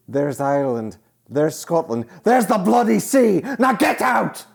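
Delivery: building to a high, loud shout; furious Scottish rant. Retold or imitated. Scottish character throwing out the Irish-joke guy, yelling. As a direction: roar them out of the shop. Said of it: "It's like".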